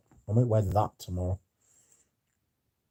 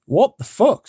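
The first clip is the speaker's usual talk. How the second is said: higher and louder, exasperated; real outrage; 11 semitones higher, 7 dB louder, slower than usual. Real, not acted. Complaining to viewers about the bill, loud exasperated outburst. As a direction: let the disbelief burst out.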